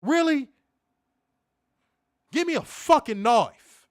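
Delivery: loud, high disbelief; exasperated outburst. Real, not acted. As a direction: blow up in frustration.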